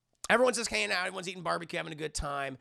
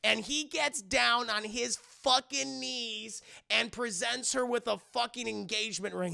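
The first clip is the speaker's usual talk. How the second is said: normal talking voice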